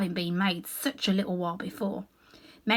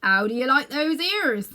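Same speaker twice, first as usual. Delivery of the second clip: lightly voiced farmer, a bit higher and louder; a proud farmer showing off his work; farmer's voice; 4 semitones higher, 6 dB louder than usual. A narrator's reading. Farmer asks the Munchkin, lightly voiced and pleased. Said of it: "the farmer saying".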